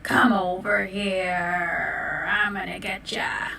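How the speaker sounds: low, slow menacing whisper; sinister whisper from behind a tree; creepy wolf whisper. Acted. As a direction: lure him in with a hushed growl. Lurking wolf calls from behind tree.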